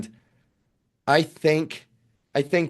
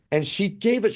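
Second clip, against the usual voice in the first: ordinary talk, nothing marked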